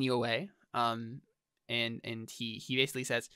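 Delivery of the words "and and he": normal talking voice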